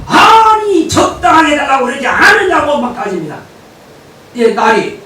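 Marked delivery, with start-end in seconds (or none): 0.0-2.8 high, loud angry protest; furious complaint. Retold or imitated. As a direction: yell like an outraged hotel guest. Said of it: "노발대발하면서".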